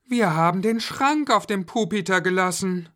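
put-on high child's voice; Julia suddenly remembering. A narrator's reading. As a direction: blurt the realisation as a girl. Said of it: "fiel Julia ein".

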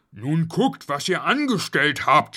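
father's cross reprimand; scolding. A narrator's reading. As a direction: scold the children for their mischief. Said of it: "schimpfte Schnuffel".

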